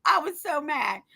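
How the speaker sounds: usual speaking voice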